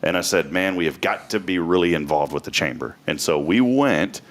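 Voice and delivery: ordinary talk, nothing marked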